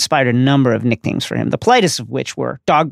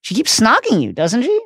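mildly baffled, pitched high; puzzled, higher-pitched check on a friend; bewildered; 9 semitones higher than usual. A narrator's reading. Harry questioning his lovestruck friend, bewildered. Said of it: "said Harry, bewildered".

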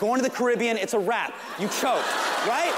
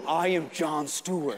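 slow, low, grand self-announcement; Jon Stewart; 5 semitones lower, slower than usual. Acted. Giant shadow approaches the nervous newcomer, grand low voice. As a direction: intone it low like a looming giant. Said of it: "I can hear his accomplishments in each step".

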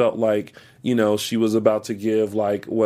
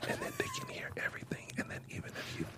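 barely audible, airy whisper; slow ASMR voice; 20 dB quieter, much faster than usual. Shown as a demonstration. Showing co-host how ASMR sounds, hushed whisper. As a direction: keep demonstrating ASMR in a tiny whisper. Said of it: "very slow voice like this".

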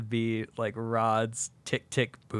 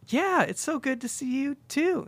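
much higher forced-cheery reply; awkward Rod; 13 semitones higher than usual. Retold or imitated. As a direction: echo her politely, nervous. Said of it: "And it's like".

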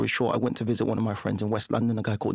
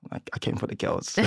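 whispered confession; sheepish whisper; 4 dB quieter than usual. Retold or imitated. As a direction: admit it under your breath.

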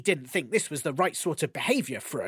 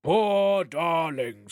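slow, crooning sympathy; slow doting giant; 3 dB louder, much slower than usual. Acted. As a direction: coo it slowly to the bride. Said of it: "said Thrym".

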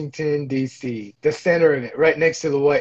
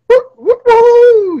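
much higher, much louder woo; excited whooping; 11 dB louder, much slower than usual. Real, not acted. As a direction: cheer the joke wildly. Reacting to the bit.

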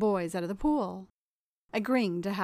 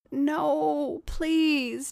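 high, louder, pleading No, please; pleading cry; 7 semitones higher, 4 dB louder than usual. A narrator's reading. Tom begging Jen before the spanking, high pleading cry. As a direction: beg in a high frightened cry. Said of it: "Tom cried out".

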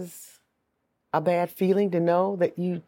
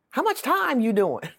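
put-on higher voice of a blunt elderly lady; old lady asking; 7 semitones higher than usual. Old lady bluntly asks the inmate her sentence, higher. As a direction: ask straight out like a no-filter grandma. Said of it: "She said".

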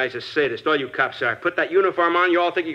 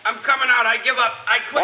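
high, raised call of surrender; 8 semitones higher than usual. Acted. The gassed suspect calls out his surrender to the cops, high and raised. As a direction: give up, calling through the door.

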